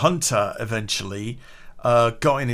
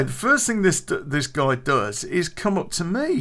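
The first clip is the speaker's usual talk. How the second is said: usual speaking voice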